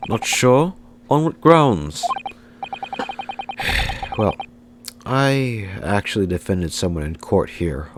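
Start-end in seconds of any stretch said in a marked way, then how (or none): 2.6-4.1 long breathy sigh. Acted. Character sighs in dialogue.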